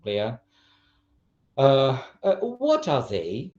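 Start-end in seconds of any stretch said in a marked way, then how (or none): 2.4-3.5 WHAT high and drawn out; slow question starting high on WHAT; changed intonation. Shown as a demonstration. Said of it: "changing intonation and changing a bit, uh, the emphasis".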